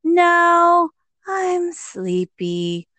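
high loud "No", then a slow, drowsy "I'm sleepy"; child whining that he's sleepy; sleepy little boy. Retold or imitated. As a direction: play the tired kid refusing to go back to the table. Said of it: "And he went".

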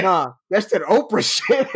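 slow, unbothered confirmation; calmly setting him straight; matter-of-fact bartender. Retold or imitated.

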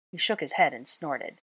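plain reading voice

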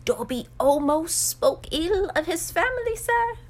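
high, aghast house elf; little elf voice climbing at the end; high-pitched creature voice. A narrator's reading. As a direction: confess as a servile elf, rising. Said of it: "in such a high-pitched voice".

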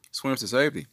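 ordinary talk

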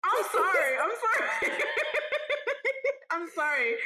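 high tipsy giggling. Real, not acted. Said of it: "I'm a little tipsy".

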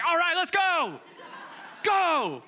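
high, loud, laughing rally cry; hyped shout. Retold or imitated. Elisha shouting the prophets out like a coach, hyped. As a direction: fire up the whole team. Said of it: "And he's like".

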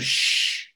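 sharp imitated shush; hushing "shh". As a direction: shush him to keep quiet. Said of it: "being quiet and whispering".

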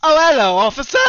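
high, loud mock English gentleman; posh English accent. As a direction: greet the officer like a prim Brit. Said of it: "same scenario, Donnie. Pull me over".

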